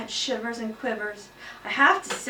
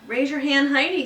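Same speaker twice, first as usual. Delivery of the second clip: firm, louder teacher's correction; name her like a strict teacher reminding a rule; lightly voiced strict teacher; a hint of a stern teacher; 6 semitones higher, 7 dB louder than usual. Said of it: "said Missus Brisbane".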